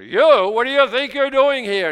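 loud, high demand; stern challenge. Acted. God challenging the intruder in the throne room, loud and high. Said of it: "he's saying".

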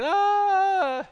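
mock panicked yell, high and loud; panicked scream. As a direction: cry out in pretend panic. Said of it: "they're panicking".

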